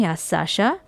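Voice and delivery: usual speaking voice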